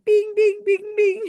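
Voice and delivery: very high ringing bings; ding like a game-show bell; imitated winning bell. YouTuber celebrates psychic's win with high bell noises.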